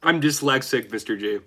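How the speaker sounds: ordinary delivery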